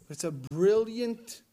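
usual speaking voice

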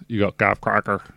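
hammed-up bad Irish accent on the name; mock Irish lilt, badly done; a deliberately bad Irish accent for a DJ's name. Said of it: "doing it in a really bad Irish accent".

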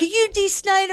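put-on high, eager little boy's voice; excited kid. Young son asking a rock star, high and eager. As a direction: pipe it up like a starstruck young son. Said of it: "Alex is like".

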